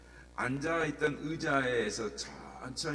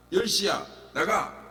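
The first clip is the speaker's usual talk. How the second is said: firm order; 5 semitones higher, 4 dB louder than usual. Retold or imitated. Preacher ordering at ten.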